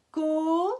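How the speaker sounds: "co-" alone, loud, with a long O; isolated stretched syllable. Shown as a demonstration. Teacher counting O sounds, loud held syllable. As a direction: hold the O of "co-" firmly. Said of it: "on l'entend encore le O".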